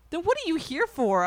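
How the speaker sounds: high, loud retold retort; exasperated challenge. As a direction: throw the question back in mock exasperation. Retelling an exasperated challenge to counselor.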